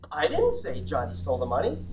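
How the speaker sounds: "I" set apart and stressed, rising questioningly at "money". Shown as a demonstration. Trainer showing a group how emphasis changes meaning, stress on I. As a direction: lean on "I", then lift the end on "money". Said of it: "where we put the emphasis".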